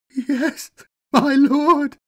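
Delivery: high, loud broken wail. Acted. Defeated governor wails his submission to his new master. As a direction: cry it out, utterly defeated. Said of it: "wailed the broken, defeated man".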